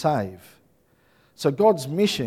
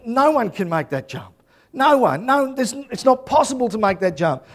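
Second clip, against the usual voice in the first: acted disbelief; 8 semitones higher than usual. Film hero facing the impossible jump.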